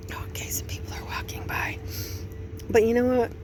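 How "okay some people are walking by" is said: sudden hushed whisper; real whisper as strangers pass. Real, not acted. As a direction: drop to a whisper so passersby won't hear. People walking by, lowers voice.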